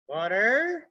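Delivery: ordinary talk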